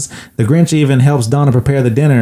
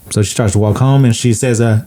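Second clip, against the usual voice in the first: ordinary talk, nothing marked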